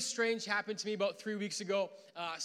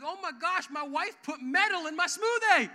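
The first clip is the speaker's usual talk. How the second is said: mock panic; 9 semitones higher, 10 dB louder than usual. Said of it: "my first thought was like".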